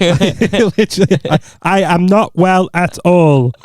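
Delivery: slow, over-the-top ailing voice; milk the fake illness; wooden, overacted poorly voice; hammed-up sick voice. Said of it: "I can't do sick".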